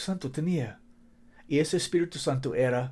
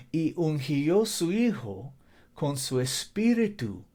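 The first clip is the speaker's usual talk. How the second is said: ordinary delivery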